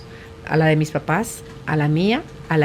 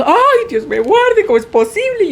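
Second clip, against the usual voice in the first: much higher, hammed-up exclamation of despair; mock dismayed parent; 11 semitones higher than usual. Grandmother mocks a parent shocked by their teen's misbehaviour. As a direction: play the scandalized parent, pitched way up.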